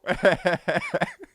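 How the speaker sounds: high mock cackle after his own joke; put-on high guffaw; imitated old man's laugh. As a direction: laugh like the pleased old heckler. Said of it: "and then he laughed".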